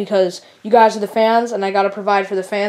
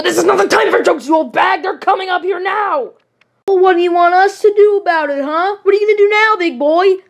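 higher, snappish squabbling voice; bickering villains; 8 semitones higher than usual. Acted. Villain snapping at partner, higher and bickering. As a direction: bicker and needle.

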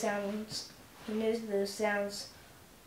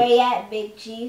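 loud holler across the street; Shorty hollers his greeting to Big Chief; hollering back; 11 dB louder than usual. Acted. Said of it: "hollered back".

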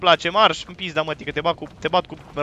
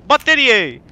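loud, higher cry for batteries; loud call-out; 7 semitones higher, 9 dB louder, much slower than usual. Real, not acted. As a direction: shout it out, pitch falling at the end. Calls out for batteries in game.

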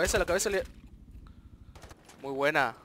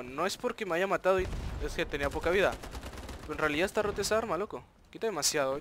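ordinary talk

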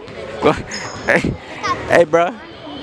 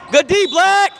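shouted praise; 8 semitones higher, slower than usual. Real, not acted. Calling out praise on court.

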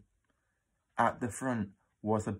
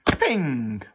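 bright bell ping of a lift; 3 semitones higher, 6 dB louder than usual. A narrator's reading. Lift arrives at floor.

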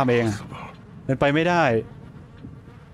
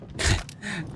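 short laugh; 7 semitones higher, 7 dB quieter than usual. Real, not acted. Reacts to enemy melting.

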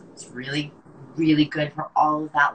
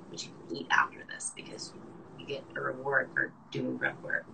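soft joking whisper; whispered aside; 5 semitones lower, 12 dB quieter than usual. Real, not acted. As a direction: whisper it as a secret. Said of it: "whispered joke aside".

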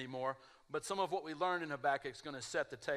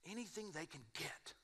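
ad pitchman; 13 dB quieter than usual. Retold or imitated. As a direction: murmur it like the ad's announcer. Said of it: "I'm trying to reenact the whole commercial".

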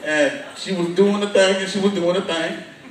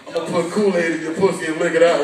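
fast, lower sleazy predator mutter; mock creep voice; 6 semitones lower, faster than usual. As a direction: rattle it off like a creep online. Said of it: "talking about".

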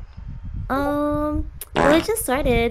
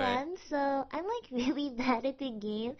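soft voice; 7 dB quieter than usual. Real, not acted. Girl's soft voice message.

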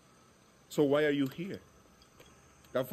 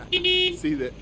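laughing burst; 7 dB louder than usual. Acted.

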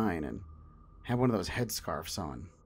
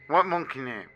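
put-on odd, raised garbled kid voice; strange kid voice; 4 semitones higher, 4 dB louder than usual. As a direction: speak strangely, like a weird kid. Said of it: "in this strange, incomprehensible voice".

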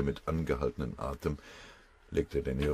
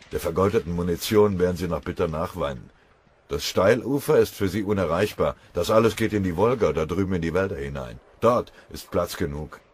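scornful taunt; 3 semitones higher, 7 dB louder than usual. Acted. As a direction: scoff at the enemy's hopes. Verächtliche Rede über den Feind.

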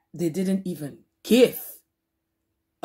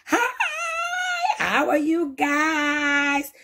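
high drawn-out greeting; 8 semitones higher, slower than usual. Real, not acted. Slow high shouted greeting.

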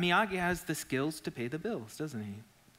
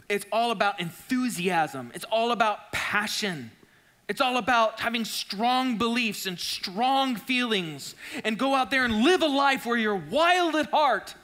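louder, higher rising sermon build; preaching; 7 semitones higher, 6 dB louder than usual. Acted. Preacher rallying the room, rising louder. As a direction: build the list to a peak.

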